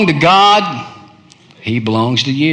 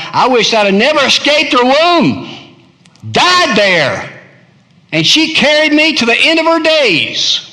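anguished lament; 11 semitones higher, 3 dB louder than usual. Acted. Preacher voicing Jeremiah's wish never to be born, loud lament. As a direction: wail the wish to heaven.

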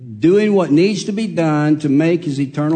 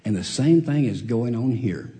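ordinary talk

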